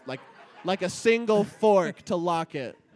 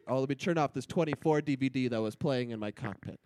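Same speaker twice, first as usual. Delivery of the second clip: usual speaking voice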